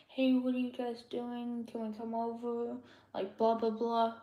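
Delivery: quietly chatting with friends on the bus, mildly hushed. Retold or imitated. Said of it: "talking in that tone of voice".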